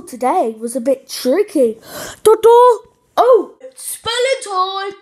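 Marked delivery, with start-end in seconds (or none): 2.2-2.8 high, loud ta-da; excited fanfare. Acted. Fanfare announcing spelling time. 3.2-4.9 high, loud excited announcing. Acted. Announcing spelling time.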